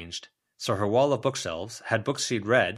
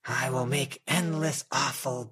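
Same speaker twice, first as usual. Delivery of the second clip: slow, hollow, unearthly pronouncement; eerie mummy voice; 3 semitones higher than usual. Acted. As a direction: intone it like an ancient dead thing, calm and indifferent. Mummy threatens.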